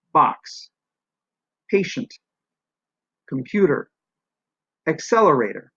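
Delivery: single words, each with one punched syllable falling away; English word stress. Shown as a demonstration. Teacher giving English stress examples, stressed syllables punched. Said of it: "think about stress and syllables in English".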